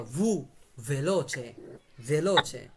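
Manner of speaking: ভু alone, then ভেলোচে with that soft V; correct V sound. Shown as a demonstration. Teacher has students copy, ভেলোচে with V as in ভু. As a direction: model ভেলোচে evenly, V at the start. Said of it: "আপনারা বলতে কি ভূতের মতো".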